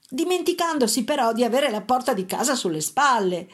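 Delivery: plain reading voice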